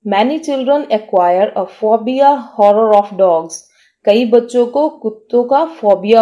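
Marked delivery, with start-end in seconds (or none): 0.1-3.6 slow, careful dictation pace; teacher reading an example sentence very slowly. Shown as a demonstration. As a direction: read it out slowly for learners to follow. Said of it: "sentence देखते हैं".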